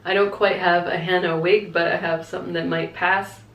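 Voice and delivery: normal talking voice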